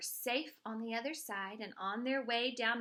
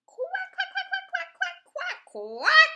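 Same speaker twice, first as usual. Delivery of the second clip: duck quacking; 14 dB louder than usual. Acted. Ducks and cars make a racket.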